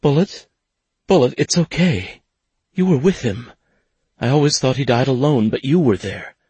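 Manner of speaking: woman pleading gently but urgently; urgent comfort. Acted. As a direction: reach him through his panic. She comforts him urgently.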